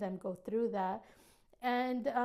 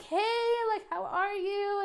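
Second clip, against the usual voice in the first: high-pitched, eager hello; excited greeting; 8 semitones higher than usual. Retold or imitated. New hugger greeting people at church, excited. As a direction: act out an excited, drawn-up greeting. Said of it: "I'm excited to be there".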